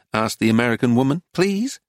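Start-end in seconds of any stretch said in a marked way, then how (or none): 1.3-1.8 high pleading please. A narrator's reading. American woman pleading.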